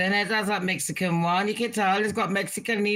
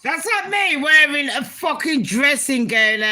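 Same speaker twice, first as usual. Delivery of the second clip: higher and louder in disbelief; mock the outfit loudly; incredulous; 5 semitones higher, 5 dB louder than usual. Real, not acted. Incredulous, mocking the dressing-gown outfit.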